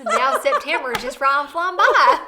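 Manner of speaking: loud, slow mock hillbilly drawl; hillbilly accent. Joking with friends about August going by, hammed-up hillbilly accent. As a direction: lay on a thick hillbilly accent, laughing through it. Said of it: "I'm so good at the hillbilly".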